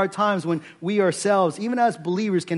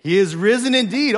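louder and higher, answering his own call; loud response; 6 semitones higher, 6 dB louder than usual. Shown as a demonstration. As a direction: shout the response for them to copy. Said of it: "you say, "He is risen indeed".

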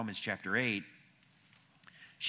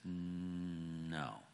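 soft, lower, drawn-out answer; hesitant no; 3 semitones lower, 7 dB quieter than usual. Retold or imitated. Woman asked whether she knows who Paul is, hesitant. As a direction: stretch the word out, as if unsure.

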